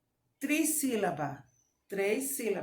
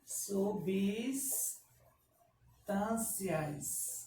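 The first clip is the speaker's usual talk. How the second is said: very slow, softer "Substâncias", broken by a long pause; syllable-by-syllable dictation; 5 dB quieter, much slower than usual. Shown as a demonstration. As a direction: split "Substâncias" with a gap before "tâncias". Teacher dictates word by syllables.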